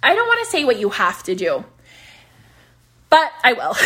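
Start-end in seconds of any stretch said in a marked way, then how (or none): none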